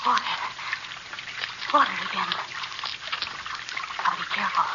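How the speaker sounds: low, soft, halting murmur; hushed muttering. Acted. Fugitive feeling the way past water, hushed muttering. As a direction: murmur warily to yourself.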